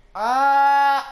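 grido acuto e forte per lo spavento; un urlo improvviso di paura. Real, not acted. Caduto nella caverna, urlo. (urlo improvviso e acuto di spavento)

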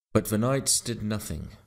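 ordinary delivery

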